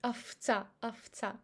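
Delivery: овца said aftsa; овца pronounced afta; devoiced v. Shown as a demonstration. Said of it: "It goes as f".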